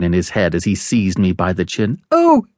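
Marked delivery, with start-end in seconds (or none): 2.1-2.5 put-on very high, frightened little boy; terrified high-pitched plea; pleading in terror. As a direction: beg for your life in a loud, high child's voice. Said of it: "I pleaded in terror".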